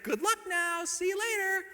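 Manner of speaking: high sing-out farewell; breezy send-off. Acted. Church sending off newlyweds after prep, breezy dismissal. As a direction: wave them off in a high, chirpy call.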